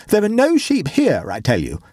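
high, exasperated protest without real volume; half-screamed, pitch leaping up then dropping; mild mock scream. A narrator's reading. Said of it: "screamed Waldo".

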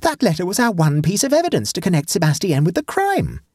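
high-pitched, dismayed outburst; aghast protest. A narrator's reading. Nephew to uncle over the destroyed evidence, aghast protest. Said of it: "gasped Egbert".

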